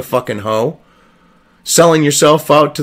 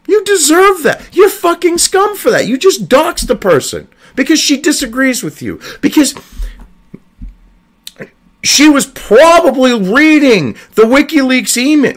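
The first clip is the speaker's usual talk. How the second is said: worked up; 8 semitones higher than usual. Real, not acted. Ranting at the reporters about the doxing, furious. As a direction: let the fury run over. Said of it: "I apologize for getting worked up".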